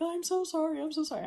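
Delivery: high-pitched, repeated apology in someone else's voice; apologetic dog owner. Retold or imitated. Dog owner to woman after the puppy jumps, high apologetic imitation.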